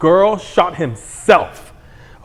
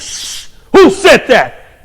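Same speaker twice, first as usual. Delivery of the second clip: mock defiant moviegoer; 6 semitones higher, 7 dB louder, much slower than usual.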